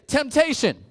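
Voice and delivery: loud, high call for the crowd to echo; called-out response. Acted. Youth pastor shouting the cue word to his crowd, loud. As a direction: belt the word out for the room. Leader calls congregation response.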